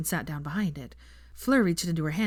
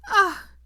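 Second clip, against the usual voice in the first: loud, high anguished cry; 7 dB louder than usual. Acted. Fleur, overcome, cries out loudly and high. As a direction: let out a terrible pained cry. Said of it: "She made a terrible sound".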